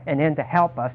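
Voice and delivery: ordinary talk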